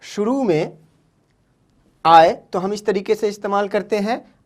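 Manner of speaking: normal talking voice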